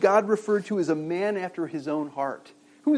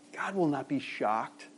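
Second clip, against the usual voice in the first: slow, softer read of the quote; slow quoted line; 4 semitones lower, 7 dB quieter than usual. Retold or imitated. Preacher reading a writer's words to the congregation, slow and soft. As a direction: let the quote sink in slowly. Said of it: "the writer says".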